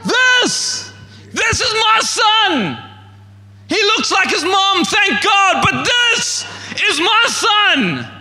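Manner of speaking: proud dad showing off his son Lion King style. Retold or imitated. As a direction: hold the baby up and shout it to the world. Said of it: "Lion King style".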